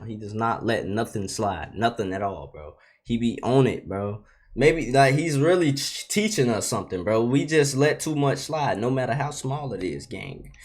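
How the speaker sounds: ordinary talk, nothing marked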